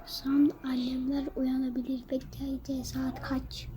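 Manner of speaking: whispering. Real, not acted. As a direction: whisper so parents won't wake. Parents may wake up.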